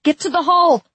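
high, loud urgent shout; crying out. A narrator's reading. Narrator as fighter ordering everyone inside, crying out. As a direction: cry the order out over the chaos. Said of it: "I cried".

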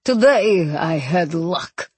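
deep, slow captain's voice; big man trying to speak low. A narrator's reading. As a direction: drop into a heavy bass, slowly. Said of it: "He lowered his deep bass voice".

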